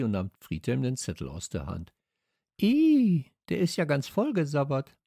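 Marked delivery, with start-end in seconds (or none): 2.6-5.0 high squeal of disgust, then complaining. A narrator's reading. Girl finds the note slobbered, disgusted. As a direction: recoil with a high, loud first cry.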